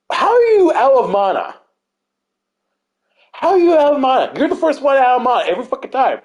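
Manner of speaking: high, incredulous retold scolding; exasperated disbelief. Cleric to fellow cleric always out of mana, exasperated disbelief.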